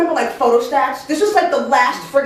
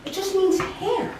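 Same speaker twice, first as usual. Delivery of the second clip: high, small-voiced explanation; timid correction; 8 semitones higher, 4 dB quieter than usual. Employee explains word to angry boss, high timid correction. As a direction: correct him gingerly, pitched up.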